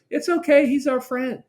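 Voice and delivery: high, sweet-talking voice to a barking dog; soothing dog owner. Retold or imitated. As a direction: coo it like an owner calming his dog. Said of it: "he hears that voice and it sounds nice".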